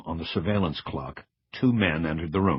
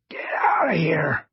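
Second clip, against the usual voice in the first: slow, forceful hoarse order; strong rasp; 11 semitones higher, 4 dB louder than usual. A narrator's reading. Cross orders the agent out, strong rasp. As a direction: rasp it with all available strength. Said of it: "his voice the clearest and strongest I had heard".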